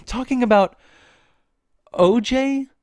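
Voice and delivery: high laughing exclamation; loud giggly disbelief. Real, not acted.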